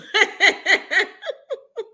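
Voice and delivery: high-pitched real laughter; cracking up. Real, not acted. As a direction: laugh high until it trails off. Cracks up at granny comment.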